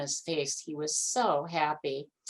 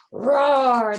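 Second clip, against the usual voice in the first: loud roar; bear roar; 7 semitones higher, 10 dB louder than usual. A narrator's reading. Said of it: "the bear roared".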